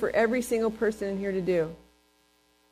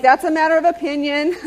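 laughing retort; 6 semitones higher, 6 dB louder than usual. Retold or imitated. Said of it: "I'm like".

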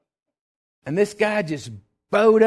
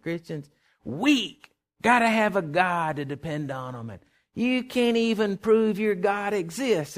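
slow scornful taunt; 7 semitones higher, slower than usual. Retold or imitated. Drunk taunting Christians.

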